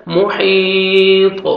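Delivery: محیط ending on a still, sukun ta; stop on محیط. Shown as a demonstration. Teacher showing how to stop on محیط. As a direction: stop cleanly on the last letter of محیط. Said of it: "stop کریں گے".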